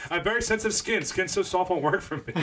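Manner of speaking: prissy mocking voice; high mock voice. Continues mock character.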